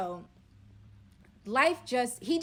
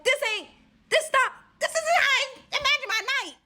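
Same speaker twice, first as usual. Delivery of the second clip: pissed-off outburst; 10 dB louder than usual. Retold or imitated. Pissed off at the robber.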